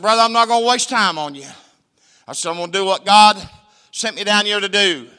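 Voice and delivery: loud, firm preaching voice; forceful preaching. Retold or imitated. Preacher to a skeptical gang member, loud and firm. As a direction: lay it down firmly. Preacher refusing to waste time.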